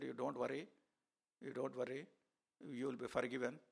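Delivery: soft, gentle comfort; hushed and kind; reassuring softly. Retold or imitated. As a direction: soothe a weeping boy, quietly. Comforting the weeping boy.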